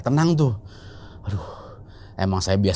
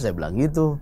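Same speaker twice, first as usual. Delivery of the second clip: plain reading voice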